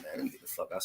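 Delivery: fed-up mutter. Retold or imitated. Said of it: "I'm like".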